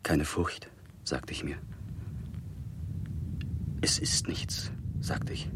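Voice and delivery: soft, half-whispered murmur; hushed self-talk. Acted. Frightened person telling themself it is nothing, hushed. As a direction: murmur it under your breath. Speaker soothing self alone.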